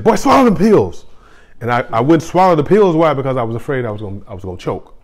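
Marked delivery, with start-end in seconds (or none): 0.0-1.0 put-on high, loud mom scolding her boy; stern mom. Mom ordering her son to swallow pills, high mock scold. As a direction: bark it like a fed-up mother. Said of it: "And she said".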